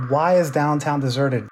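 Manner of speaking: ordinary talk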